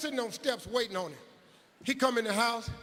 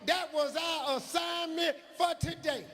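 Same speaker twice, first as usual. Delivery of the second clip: put-on much higher, protesting child's cry; indignant kid shout; 9 semitones higher than usual. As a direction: insist like a wronged little boy. Kid defends answer.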